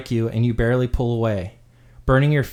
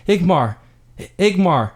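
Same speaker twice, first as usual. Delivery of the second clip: higher, louder desperate calls; calling out; 6 semitones higher, 5 dB louder than usual. Acted. Boy over the soldier's shoulder calling for brother, loud. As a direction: cry out for your brother as you're carried off.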